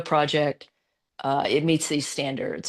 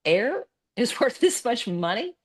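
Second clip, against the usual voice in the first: incredulous; 7 semitones higher than usual. Retold or imitated. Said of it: "their first reaction was".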